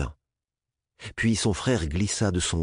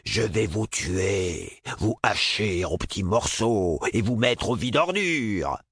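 slow, hammed-up monster growl; fake monster voice; slower than usual. A narrator's reading. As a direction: play a mock monster. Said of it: "d'une fausse voix de monstre".

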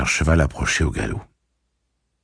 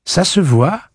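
curt reply; 13 semitones higher, 6 dB louder, slower than usual. Acted.

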